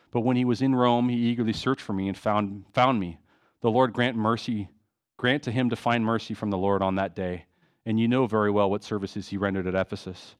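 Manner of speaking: low, even reading voice; scripture reading. Acted. Preacher reads Paul's letter to the congregation, low scripture-reading voice.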